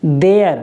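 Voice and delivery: देयर said clearly as dare-uh with a falling close; देयर pronounced as the English word there; model word. Shown as a demonstration. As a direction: teach देयर cleanly, like dare. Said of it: "इसको हम क्या पढ़ते हैं".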